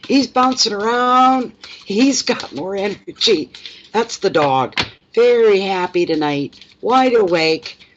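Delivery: high, drawn-out cooing exclamation; playful pet talk. Real, not acted. Owner describing her bouncy wide-awake dog, playful high drawl. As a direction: stretch the words out at an excited pet.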